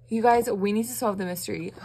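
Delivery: normal talking voice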